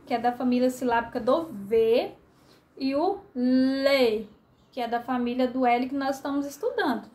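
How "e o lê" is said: "lê" stretched slowly, sounded out clearly; slow down on "lê" as if spelling it out; teacher sounding out the syllable "lê" for first graders; drawn-out syllable. Shown as a demonstration. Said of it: "Nós temos a sílaba".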